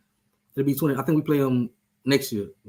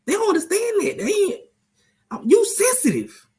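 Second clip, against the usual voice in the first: much higher, teasing falsetto; mocking squeaky voice. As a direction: squeak it out to needle your friend. Repeats the tease in a squeaky voice.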